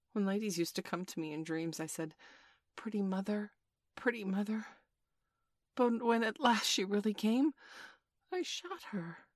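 wistful recollection rising to a high, faint ending; reflecting. A narrator's reading. Toodles recalling dream mothers to the boys, sadly reflective. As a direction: remember tenderly, then let it thin out in anguish. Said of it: "he said, reflecting".

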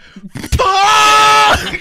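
loud, high yell of fright; reenacted shriek, drawn out; startled yell. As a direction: scream the curse as if jump-scared. Reenacting his startled yell at screen.

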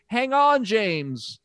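high, loud cry of alarm; female lead. Retold or imitated. Bond girl's alarmed call to a dangling Bond, mock high. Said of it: "the female lead says".

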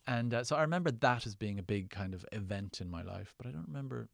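usual speaking voice

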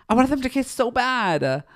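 high and loud, bursting with longing; excited squeal. Real, not acted. Fan telling viewers she longed for the kiss, squealing.

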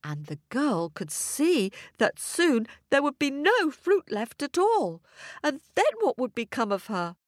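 plain reading voice

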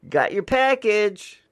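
high, loud, slow goofy voice; silly high take. Pretend. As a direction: ham up the delivery line.

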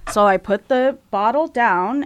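usual speaking voice

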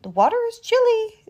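high, fast fish voice. A narrator's reading. Narrator voicing the fish, high and fast. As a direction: squeak it out quickly. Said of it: "says the fish".

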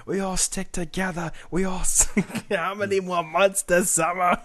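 high slow mocking Boston voice; mock Boston local. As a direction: caricature a proud Bostonian, drawn out.

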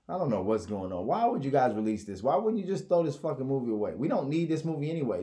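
ordinary talk, nothing marked

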